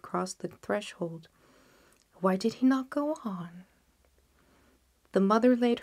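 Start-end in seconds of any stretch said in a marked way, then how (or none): none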